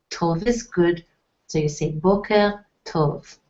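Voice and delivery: ordinary talk, nothing marked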